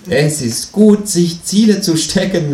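high, cheeky kangaroo voice. Acted. Reading aloud, the narrator voices the Kangaroo asking him to pay, high and cheeky. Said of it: "lobt das Känguru".

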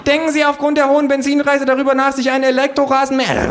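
very high, loud questioning; shrill interviewer cut short; doomed pollster. A narrator's reading. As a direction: shriek the survey question as the mower nears. Pollsters survey while being mown down.

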